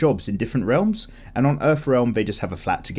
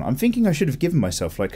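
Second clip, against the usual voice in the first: ordinary talk, nothing marked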